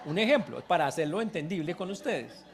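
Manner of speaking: loud, laughing aside; laughing aloud. Real, not acted. Preacher joking with congregation, loud laughter. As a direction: laugh it out to the crowd.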